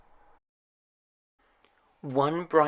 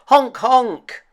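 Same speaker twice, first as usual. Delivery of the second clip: much higher, much louder honking; excited honks; 12 semitones higher, 12 dB louder than usual. A narrator's reading. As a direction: honk for joy in the air. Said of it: "he cried in excitement".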